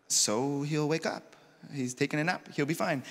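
soft, casual puzzlement; mildly confused, softly reasoning; puzzled disciples. Acted. Disciples misunderstand, voiced lightly.

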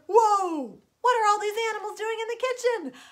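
loud, high, quick outburst; mock-alarmed storybook farmer; startled farmer. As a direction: wake up shocked and exclaim. Farmer wakes and finds the animals.

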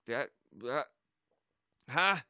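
ordinary talk

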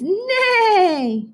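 high, long whinnying neigh; horse neigh. Shown as a demonstration. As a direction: stretch the word into a neigh.

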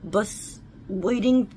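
ordinary talk

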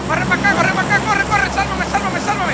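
high frantic yelling for help; panicked shouting. Real, not acted. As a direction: yell it in real panic, high and loud. Panicked call for help in game.

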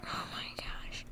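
breathless whisper. Real, not acted. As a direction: hush it under your breath. Hushed reaction.

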